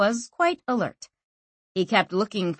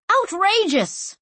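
outraged; 12 semitones higher, slower than usual. A narrator's reading. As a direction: call it out in indignant protest. Council members protest.

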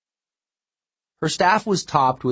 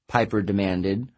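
usual speaking voice

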